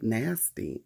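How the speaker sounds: ordinary talk, nothing marked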